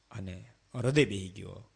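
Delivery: normal talking voice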